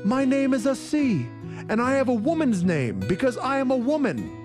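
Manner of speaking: clear, resounding exclamation; sonorous voice. Acted. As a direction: ring out the answer in triumph. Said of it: "exclaimed in a sonorous voice".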